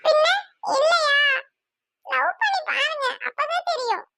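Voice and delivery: high, whiny sulk; weepy lovesick whine; tearful retort. Acted. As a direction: protest through tears in a high voice. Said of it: "அதுக்காடா அழுகுற?".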